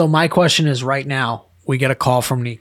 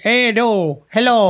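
high-pitched mock Wisconsin accent; 8 semitones higher, slower than usual. Shown as a demonstration. Co-host showing off the worst accents, high mock Wisconsin accent. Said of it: "the Wisconsin accent".

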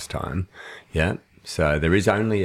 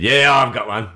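louder and higher, a keen manager; loud eager manager; 6 semitones higher, 8 dB louder than usual. Retold or imitated. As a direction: pop up keen, hand raised. Manager voiced in story.

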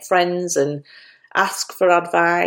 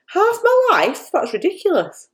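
surprised; 13 semitones higher than usual. Real, not acted. Shocked at her own age.

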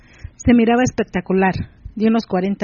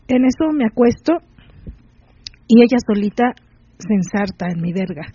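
plain reading voice

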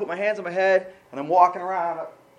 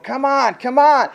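louder, higher exasperated come on; exasperated plea to God, raised voice; frustrated pleading; 6 semitones higher, 9 dB louder, slower than usual. Retold or imitated. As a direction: beg with rising frustration.

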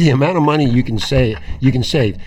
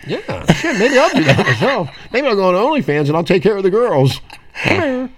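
high mock excitement; 8 semitones higher than usual. Joking about OnlyFans.

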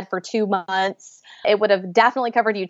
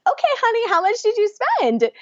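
much higher, sugary insistence; insisting; 11 semitones higher than usual. Retold or imitated. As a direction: repeat the question sweetly but firmly. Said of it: "I'm like".

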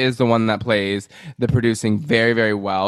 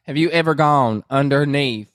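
put-on Southern accent; 3 semitones higher than usual. Mimicking Sutton grilling Kyle in a Southern accent. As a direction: grill her like a Southern auntie. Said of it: "You have a good Southern accent".

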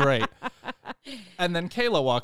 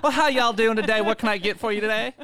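put-on Southern accent; 9 semitones higher, 7 dB louder than usual. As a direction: lay on a friendly Southern accent. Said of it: "just pick a different accent every time".

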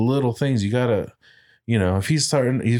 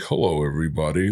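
imitated American accent; 5 semitones lower than usual. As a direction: lower mock American accent. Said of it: "doing an American accent".

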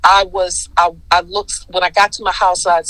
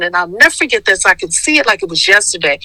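ordinary talk, nothing marked

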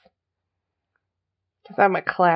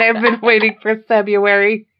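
usual speaking voice

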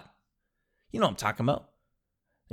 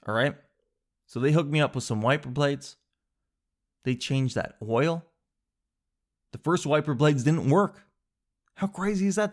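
usual speaking voice

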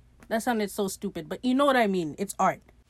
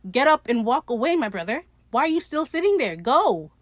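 loud, raised-pitch mock telling-off; exasperated scolding; 5 semitones higher, 6 dB louder than usual. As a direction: order them out, loudly exasperated. Scolding a character who lingers.

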